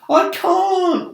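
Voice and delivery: high loud wailing. Acted. Glowworm lamenting her broken bulb, high wail. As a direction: wail it miserably. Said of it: "wailed the glowworm".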